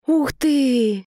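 high delighted wow; amazed. A narrator's reading. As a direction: gasp it out in wonder. Boy sees puppies.

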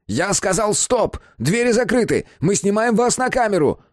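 high-pitched commanding call; high, insistent warning; shouted order. A narrator's reading. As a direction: order a runaway to halt. Ordering a fleeing man to stop.

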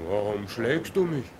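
ordinary delivery

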